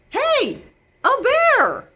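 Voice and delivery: high, loud "Hey, a bear!"; excited cry. Acted. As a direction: call out in surprised delight. Captain Cook spots a bear.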